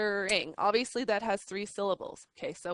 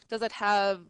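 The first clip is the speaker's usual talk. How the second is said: normal talking voice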